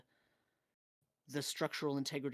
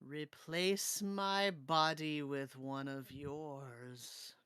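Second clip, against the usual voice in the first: very slow, drawn-out ghost voice; slow spectral demand; cursed spirit; much slower than usual. Acted. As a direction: stretch each word like a sinister spirit.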